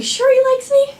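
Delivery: high-pitched fretful question; anxious high squeak. Retold or imitated. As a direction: squeak it out like a worried teen. Anxiously asking friend about boy.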